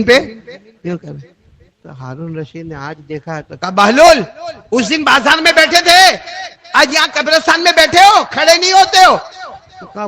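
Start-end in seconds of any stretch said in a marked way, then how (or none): 3.7-9.2 high, loud scolding shout; angry king's shout. Acted. King Harun Rashid scolding Bahlul in the graveyard, loud angry shout. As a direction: yell at him for staying seated.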